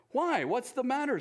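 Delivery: high, concerned little bear; cheery Pooh. Acted. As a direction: ask kindly and brightly. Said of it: "said Pooh".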